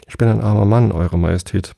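ordinary delivery, nothing marked

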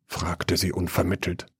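ordinary delivery, nothing marked

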